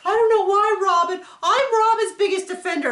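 high, loud whiny mimic of the wife; mock Mary voice. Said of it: "she's just like".

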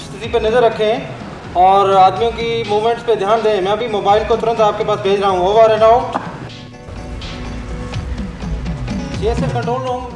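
crackly two-way radio transmission; walkie-talkie radio. Real, not acted. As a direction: speak through a handheld radio. Control room radio instructions.

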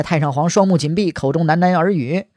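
usual speaking voice

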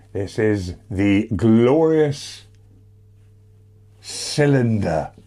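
slow, grand showman reveal. Pretend. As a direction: announce it like a prize. Grand announcement.

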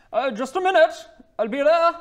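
high, loud call to the door; voice behind the door. Acted. Young woman answers a knock from inside, high loud calling. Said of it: "You hear a voice".

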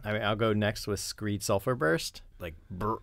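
normal talking voice

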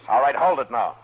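loud order to stop; commanding shout. Acted. Marshal stopping the draw, loud command. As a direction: bark it out to freeze everyone.

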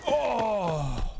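surprised. Real, not acted. Sudden hit in firefight.

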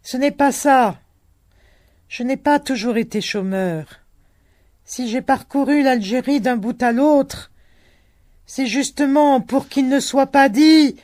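put-on old peasant shouting his grievance; old man shouting. A narrator's reading. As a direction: shout it over the wind.

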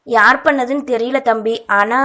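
plain reading voice

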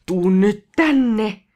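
loud, drawn-out mock yell; imitated shout. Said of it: "Mä oikein huusin silleen". (venytetty, matkittu huuto)

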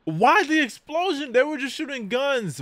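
high, loud shocked outcry at the screen; stunned shouting. Real, not acted. Fan reacting to an anime explosion, shouting in shock. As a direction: yell in disbelief as the explosion hits.

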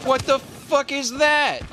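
alarmed, slow, high outburst; startled shout at a surprise; panicking gamer. Real, not acted. Sudden threat in game.

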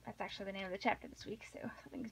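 fast hushed aside; quick conspiratorial whisper. Real, not acted. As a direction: whisper it quickly, teasing suspense. Whispered aside before chapter.

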